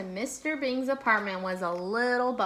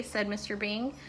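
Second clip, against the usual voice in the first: ordinary delivery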